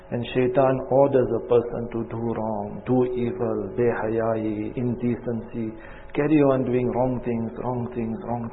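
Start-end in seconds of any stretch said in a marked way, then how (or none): none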